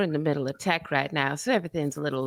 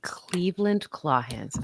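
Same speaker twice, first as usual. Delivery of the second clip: whispered. Real, not acted. Repeating the dragon's name.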